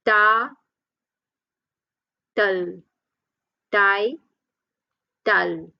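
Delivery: "Ta, tal, Tai, tal" with long gaps; syllable by syllable. Shown as a demonstration. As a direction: sound out each half separately, Tai, then tal. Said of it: "Two syllable".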